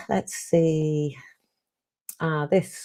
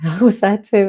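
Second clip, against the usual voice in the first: laughing; 4 semitones higher, 9 dB louder than usual. Real, not acted. Laughing admission of same mess.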